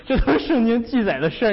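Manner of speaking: laughing loudly through the words; can't hold back the laughter. Real, not acted. Preacher laughing at the comic Bible scene, loud and amused.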